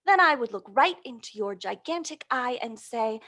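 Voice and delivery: gentle picture-book read-aloud; storybook reading voice; reading to children. Acted. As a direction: read it like a bedtime story to little ones.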